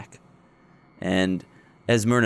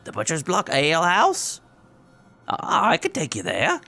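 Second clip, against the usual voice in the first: odd woman voice; 8 semitones higher than usual. Acted.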